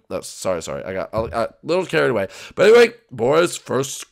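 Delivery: ordinary talk, nothing marked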